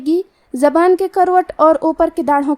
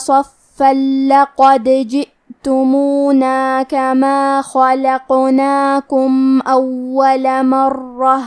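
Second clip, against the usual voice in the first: slow, melodic tilawat; solemn chanted Quran recital; Quran recitation; slower than usual. Shown as a demonstration. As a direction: recite the verses slowly with measured tajweed. Teacher recites the verses again.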